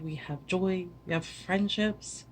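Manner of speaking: ordinary talk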